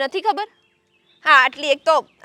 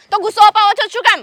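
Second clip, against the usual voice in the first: louder, pointed question; heated accusation; 4 semitones higher, 6 dB louder than usual. Acted. Wife accuses husband of provoking her, heated. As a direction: demand it of him with heat.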